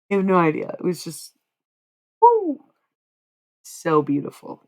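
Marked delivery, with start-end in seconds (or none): 2.2-2.6 sharp high-pitched "woo" of overwhelm; high loud woo. Real, not acted. Overcome telling of a beautiful funeral song, high loud woo. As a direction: let out a loud, high whoop.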